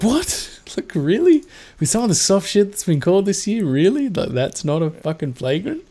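laughing, high-pitched what-the-hell outrage; incredulous disbelief. Retold or imitated. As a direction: squeal it out like a fan who can't believe the no-call. Fan baffled by a no-call.

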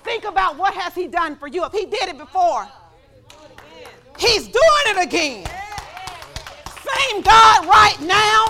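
high, loud, slow call to praise; preaching at full force. Acted. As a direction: whip the congregation into shouting.

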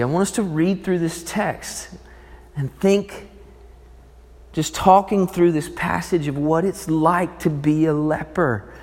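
normal talking voice

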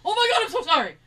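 high, loud, hurried sorry; panic-apologize for the alarm; lightly voiced flustered husband; flustered apology. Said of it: "he comes running in".

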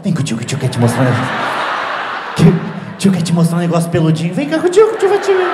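hammed-up creepy uncle luring a kid; party guest luring a child to the gift table, in a creepy coaxing voice. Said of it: "que parecia um pedófilo".

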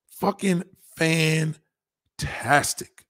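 very slow savoring fucking fantastic; drawn out. Shown as a demonstration. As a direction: stretch every syllable of fucking fantastic.

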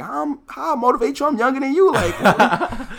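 incredulous giggle at being praised; laughing in disbelief. Real, not acted.

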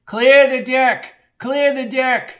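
high, slow, drawn-out yell; screamed warning. A narrator's reading. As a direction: scream it out to clear a crowd. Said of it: "screamed Bucky".

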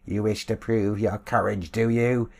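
a bully mocking his victim; sneering bluecoat leader. Bluecoat leader taunts the beaten old man, sneering loudly.

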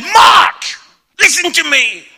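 high, loud furious yell, imitated; angry wife. Wife to husband while driving home, imitated angry shout. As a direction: shout it as a wife at the end of her tether. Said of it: "she was angry".

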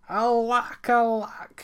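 high, drawn-out lament; moaned. A narrator's reading. Miller laments under the blankets, moaned. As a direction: wail it in storybook woe. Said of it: "he moaned".

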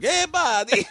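very high, thick put-on Italian accent; mock accent greeting. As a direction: squeal it as a cartoon Italian. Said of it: "you came up to me like".

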